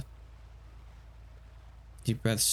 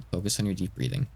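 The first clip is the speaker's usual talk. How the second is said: usual speaking voice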